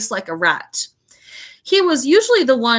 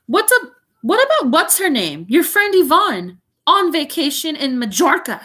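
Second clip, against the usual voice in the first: snapping Aunt Petunia; 6 semitones higher, 3 dB louder than usual. A narrator's reading. Aunt snapping at husband about sister. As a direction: bite the words off impatiently. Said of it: "snapped Aunt Petunia".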